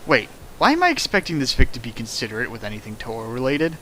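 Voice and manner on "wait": normal talking voice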